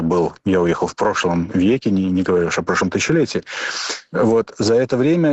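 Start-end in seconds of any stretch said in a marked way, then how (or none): none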